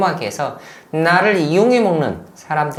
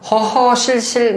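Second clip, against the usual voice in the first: awkward chuckle; 8 semitones higher, 6 dB louder, slower than usual. Real, not acted. Awkward laugh before answering.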